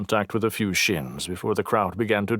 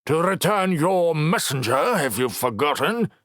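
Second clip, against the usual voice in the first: glowering; 9 semitones higher, 3 dB louder than usual. Acted. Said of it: "Dedan glowered at her".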